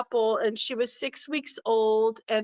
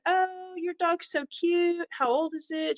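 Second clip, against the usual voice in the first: cooing to puppy; 8 semitones higher than usual. Retold or imitated. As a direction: gush gently, as if the pup is right there. Cooing over a stranger's puppy.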